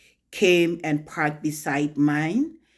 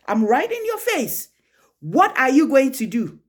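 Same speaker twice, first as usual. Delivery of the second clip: raised, heated face-to-face challenge; defiant challenge; 7 semitones higher, 4 dB louder than usual. Retold or imitated. Woman getting into the husband's face, defiant. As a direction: throw the dare back, high and forceful.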